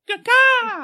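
sudden high shrill squawk. Retold or imitated. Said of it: "we have a secret, secret sound".

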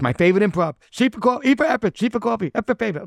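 high, loud excited recital; imitated delight. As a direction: gleefully reel off the old bit. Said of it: "He's like".

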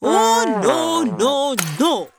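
high, drawn-out storybook refusal; hammed-up royal anger, high-pitched; angry king. A narrator's reading. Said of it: "the king answered angrily".

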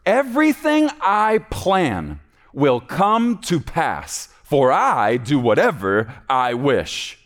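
drawn out, slow, rising on "Everything"; God declaring his plans will stand; slow sovereign decree. Acted. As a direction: stretch every word like a royal decree. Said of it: "Watch this next part".

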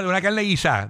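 ordinary delivery

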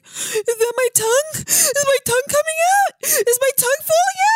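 very high, alarmed questions; mock dazed panic. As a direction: imitate a woozy patient in hammed-up distress. Imitating dazed, alarmed patient.

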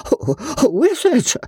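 high, flustered stammer; in a flutter. A narrator's reading. Joss asks the masked stranger's identity, in a flutter. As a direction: stumble over the question in a fluster. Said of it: "asked Joss in a flutter".

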